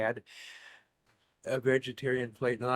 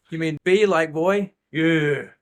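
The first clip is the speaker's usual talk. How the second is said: mock loud phone clarifying; 3 semitones higher, 9 dB louder, slower than usual. Acting both sides of call.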